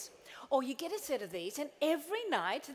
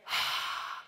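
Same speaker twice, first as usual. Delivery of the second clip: breath out. Shown as a demonstration. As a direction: demo exhale. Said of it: "and then".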